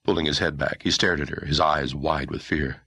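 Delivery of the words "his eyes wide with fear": plain reading voice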